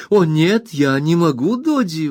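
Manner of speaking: put-on high pleading cry of a young wife; high alarmed protest from the little wife; wife's exclamation. A narrator's reading. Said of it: "воскликнула она".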